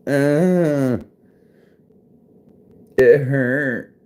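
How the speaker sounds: high put-on whine over a sore finger; whiny pained voice. Complaining about hammered finger.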